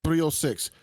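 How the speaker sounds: ordinary talk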